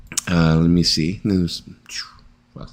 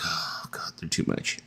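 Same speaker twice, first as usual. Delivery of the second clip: breathy unvoiced complaint; whispered grumble; 15 dB quieter than usual. Fed-up parent complaining about the kids, whispered.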